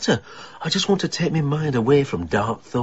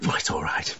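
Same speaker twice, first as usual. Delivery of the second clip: whispered reassurance; 10 semitones lower, 7 dB quieter than usual. Real, not acted. Grieving widower reassuring friend.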